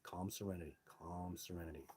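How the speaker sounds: soft, quiet repeated mantra; calm mantra. Retold or imitated. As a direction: murmur it gently to calm yourself. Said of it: "I was like".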